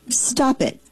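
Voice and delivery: ordinary talk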